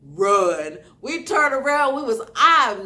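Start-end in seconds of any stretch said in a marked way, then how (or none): 0.0-0.8 loud one-word command; forceful acted-out warning; retold urgent shout. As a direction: re-enact ordering a friend to flee. Retold order to flee attackers.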